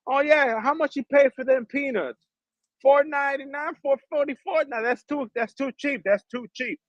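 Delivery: high, sing-song mock of a seller; mocking greedy seller.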